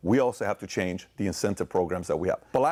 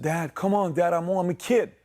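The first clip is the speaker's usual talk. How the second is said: put-on higher, whiny boy's voice pleading with dad; pleading kid; 8 semitones higher than usual. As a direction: beg like a little kid. Son pleading with dad in story.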